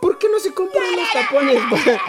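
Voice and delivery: sugerencia burlona más aguda; ofrécelo con fingida amabilidad; sarcasmo. Retold or imitated. Operadora sugiere tapones. (la operadora sugiriendo tapones, burlona)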